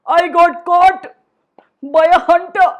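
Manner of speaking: plain reading voice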